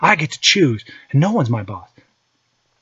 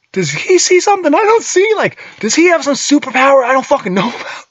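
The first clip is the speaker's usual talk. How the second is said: much higher, louder panicked inner voice; mock scared thought; 9 semitones higher, 3 dB louder than usual. Dad charged by his five-year-old, hammed-up panic. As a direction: play the terrified adult. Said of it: "I was scared".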